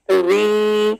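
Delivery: normal talking voice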